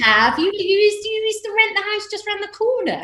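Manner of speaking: high, loud disbelieving; surprised. Real, not acted. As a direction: gasp at the news.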